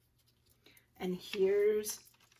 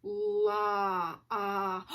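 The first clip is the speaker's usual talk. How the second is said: "La, ah" with the open ah stretched and repeated; slow sounding-out; 2 semitones lower than usual. Shown as a demonstration. Teacher checking log for its vowel, slowly sounded out. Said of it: "Let's say this one slow".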